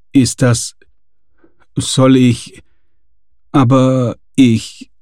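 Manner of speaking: halting, broken-off words; stammering. A narrator's reading. Gasperlmeier stunned by the plane ticket, stammering. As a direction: stumble over each start. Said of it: "stammelte er".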